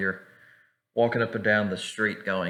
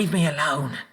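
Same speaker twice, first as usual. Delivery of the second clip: higher, irritated halfling cry; mock angry rebuff; 7 semitones higher than usual. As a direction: shake off a pest. Halfling rebuffs the player, flees.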